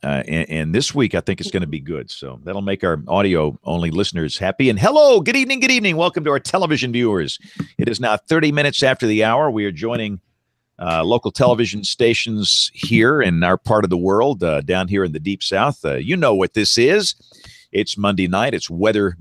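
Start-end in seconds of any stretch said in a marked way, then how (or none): 4.7-13.2 bright broadcast welcome, raised pitch; big TV host opening; announcer voice. Acted. Opens show for TV viewers.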